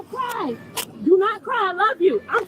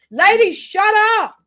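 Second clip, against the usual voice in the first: slow, loud "shut up" at the screen; mock yell; 4 semitones higher, 6 dB louder, slower than usual. Reactor snapping at the woman in the clip, slow and loud.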